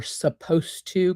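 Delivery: mock the word supposed; ironic air quotes. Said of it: "quote, unquote".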